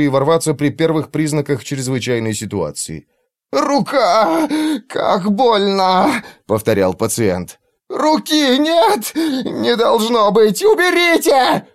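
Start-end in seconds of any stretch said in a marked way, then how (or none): 3.5-6.2 high, slow pained wail; wounded soldier. Acted. Patient screaming in pain. 7.9-11.7 high, slow anguished cries; frenzied screaming. Acted. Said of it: "закричал он и в приступе бешенства".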